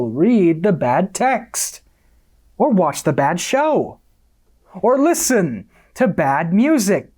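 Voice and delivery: high-pitched, preachy declamation; impassioned lecturing. Acted. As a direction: preach it with mounting conviction. Self-righteous sermon on good content.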